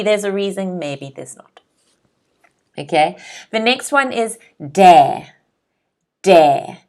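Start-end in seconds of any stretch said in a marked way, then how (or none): 4.8-5.3 "dare" as one firm, falling syllable; model pronunciation. Shown as a demonstration. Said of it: "Can everyone say dare at home?". 6.2-6.8 "Dare" as one firm, falling syllable; model pronunciation. Shown as a demonstration. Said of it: "Can everyone say dare at home?".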